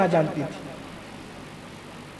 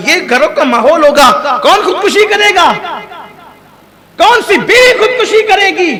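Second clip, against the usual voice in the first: fervent preaching; 7 semitones higher, 4 dB louder than usual. Acted. Preacher rising to full force.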